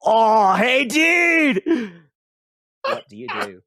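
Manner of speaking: high, loud, happy stoner "Oh, hey, dude!"; stoner elf greeting. Acted. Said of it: "you hear him go".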